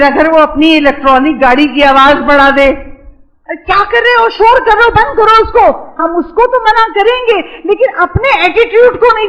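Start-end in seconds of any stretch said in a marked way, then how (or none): 3.5-5.9 put-on high, sharp telling-off of a noisy child; imitating an irritated parent stopping the noise; mock scolding. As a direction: snap at the kid to shut the toy off.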